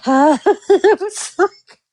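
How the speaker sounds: giggling while talking; laughing. Real, not acted. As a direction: laugh through the words.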